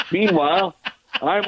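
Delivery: high laugh spilling into the next word. Real, not acted. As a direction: laugh and push on with the story. Laughs while telling the story.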